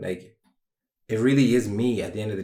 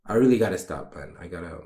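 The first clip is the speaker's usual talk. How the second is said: ordinary talk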